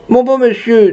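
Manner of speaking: high, plaintive appeal; high-pitched acted lament, no real sobbing; tearful old lady. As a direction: plead like a weeping old woman. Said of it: "dit une vieille dame qui pleurait".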